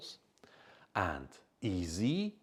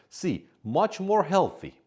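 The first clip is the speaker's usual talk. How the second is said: C, much more healthy called high and loud; option read out; 12 semitones higher, 8 dB louder than usual. Shown as a demonstration. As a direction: announce the third choice. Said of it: "We don't use more".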